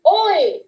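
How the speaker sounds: "Oi" said a little annoyed; oi sound. Shown as a demonstration. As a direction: model the oi sound. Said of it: "O I and O Y say".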